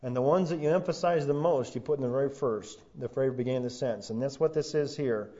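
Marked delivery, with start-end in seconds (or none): none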